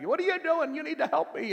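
high, loud imitation of an exasperated worker; mock screaming. Sicilian coworker hollers at Michael on the conveyor line, screaming. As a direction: yell it high-pitched, demanding help. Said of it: "was screaming at him".

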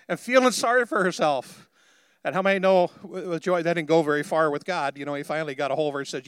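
ordinary talk, nothing marked

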